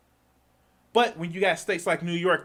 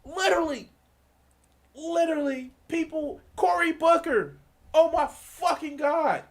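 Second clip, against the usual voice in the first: exasperated outburst; 11 semitones higher than usual. Real, not acted. Exasperated outburst about Cory Booker.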